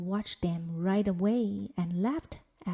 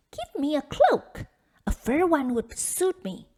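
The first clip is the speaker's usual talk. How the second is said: higher, louder bratty demand; spoilt demand; 6 semitones higher, 8 dB louder than usual. A narrator's reading. Nona demanding a fur cloak from Father Frost, bratty. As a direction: order it like a spoilt child.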